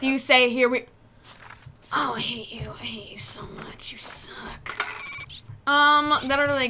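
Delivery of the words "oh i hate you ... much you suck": very soft whisper; hushed mock hatred, barely audible; whispered grumble. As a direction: whisper the insult under your breath. Muttering at entrant under breath.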